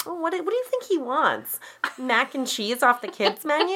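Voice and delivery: high-pitched feigned uncertainty to a waiter; mock-innocent asking. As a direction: ask it high and innocently, putting it on. Acting innocent while ordering for baby.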